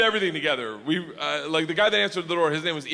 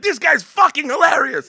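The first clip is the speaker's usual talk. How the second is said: loud, high, hyped-up approval; mock a gang roaring with approval; raucous delight; 11 semitones higher, 10 dB louder than usual. Gangsters roar approval, acted.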